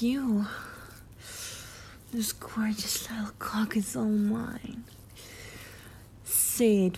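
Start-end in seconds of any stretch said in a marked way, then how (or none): 2.1-4.8 ASMR whisper. Acted. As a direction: lean in close and whisper it. Erotic roleplay, whispered intimacy.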